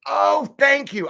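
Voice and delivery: high, loud burst of thanks; sudden delighted realization, pitch jumping up; pleasantly surprised. Real, not acted. Grasps how viewer's name is said.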